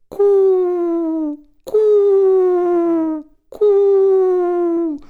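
high, long held coos; bird imitation. Brother hides up the tree from his sister, bird imitation. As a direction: coo like a bird on one high note. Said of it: "Her brother up in that tree goes".

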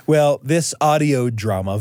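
ordinary talk, nothing marked

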